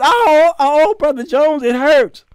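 high-pitched mock moaning in pain; imitating a complainer's aching wail; ailing church member. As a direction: moan it out, hammed-up and high.

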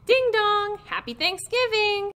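high, loud sing-out greeting; storybook character's high-pitched holiday greeting; cheery doorbell call. A narrator's reading. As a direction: call it out high at the front door. Turkey calls out at the door.

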